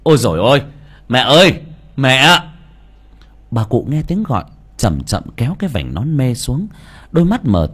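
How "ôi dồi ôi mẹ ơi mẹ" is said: louder calling out to mother; shouting. Acted. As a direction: call across the bus station. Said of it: "vừa đi vừa hét".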